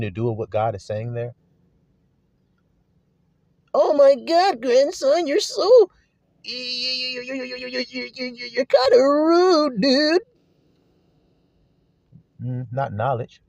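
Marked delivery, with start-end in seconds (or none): 3.7-10.2 high, drawn-out mock whine with a stuttering "you"; mocking falsetto complaint; whiny offended complainer. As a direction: act out an offended whiner, pitched way up. Mock whining reply of listener.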